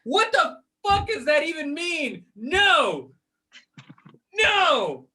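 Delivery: high, exasperated outburst; emphatic, worked-up fury; blowing a fuse. Real, not acted. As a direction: refuse it in comic outrage. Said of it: "I blew a fucking fuse".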